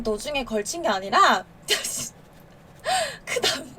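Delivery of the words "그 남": can't get the word out for laughing; giggling breakdown mid-word; laughing fit. Real, not acted. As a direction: voice cracks into breathless laughter.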